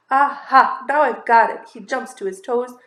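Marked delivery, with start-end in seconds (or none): 0.1-1.7 triumphant discovery. Acted. Marvin discovers the secret.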